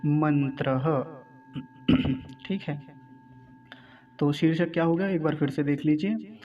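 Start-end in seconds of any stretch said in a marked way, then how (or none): 0.1-1.1 slow "मंत्रः" as man-tra-ha; short a before the final ha in "मंत्रः"; careful, split singular "मंत्रः"; singular short ending. Shown as a demonstration. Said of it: "एक मंत्र है".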